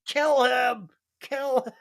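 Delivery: high-pitched imitation of a desperate scream; mock scream. Pleading with Murtaugh to avenge his daughter, mock scream. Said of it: "he's screaming".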